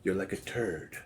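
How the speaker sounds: low, soft silly voice; funny voice. Pretend. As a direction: drop into a goofy low voice. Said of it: "I got an interesting voice".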